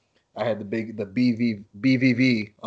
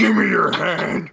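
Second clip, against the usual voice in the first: higher, louder, slow mock demand; podcast host imitating a grieving wrestler's forceful plea, loud mock demand; aggressive Vader; 9 semitones higher, 7 dB louder, slower than usual. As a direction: ham up a forceful grab for the hand. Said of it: "Just the way he said, so aggressively".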